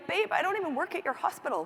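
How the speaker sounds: high, quick mock husband voice; a light imitation of a calm husband.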